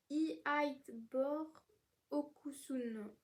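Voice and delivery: very slow, careful sounding-out; struggling to pronounce. Real, not acted. Book vlogger attempting a difficult author name, slow and careful. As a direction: feel your way through an unfamiliar name. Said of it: "c'est hyper dur à dire son nom".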